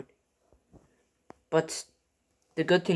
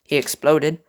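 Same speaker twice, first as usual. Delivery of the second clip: ordinary delivery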